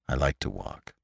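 low, very soft refusal; weary walker. A narrator's reading. Lantry declining the driver's ride, weary.

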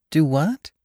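high, startled question; startled wife asking. A narrator's reading. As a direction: caught off guard, high pitched. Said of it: "she asked, startled".

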